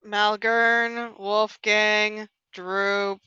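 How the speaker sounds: slow, level listing of names; reluctant, drawn-out roll call; unenthusiastic. Real, not acted. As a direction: list them with no enthusiasm at all. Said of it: "She sounds so enthusiastic when she's saying this".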